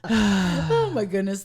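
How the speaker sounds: ordinary delivery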